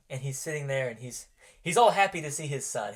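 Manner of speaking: normal talking voice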